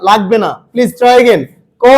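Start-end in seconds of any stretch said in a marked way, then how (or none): 1.8-1.9 an unaspirated "ক", breath held back; অল্পপ্রাণ উচ্চারণ. Shown as a demonstration. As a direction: say "ক" with hardly any puff of air. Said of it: "অল্প প্রাণের ধ্বনি উচ্চারণের সময়". ("ক" অল্প বাতাসে)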